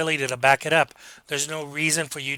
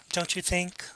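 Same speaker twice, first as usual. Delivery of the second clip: polite little nudge to agree; condescendingly polite; 3 semitones higher, 9 dB quieter than usual. Shown as a demonstration. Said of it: "people who are very condescending".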